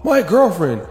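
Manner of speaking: high-pitched mock read-out; put-on high commenter voice, easing toward normal at the end; Myron.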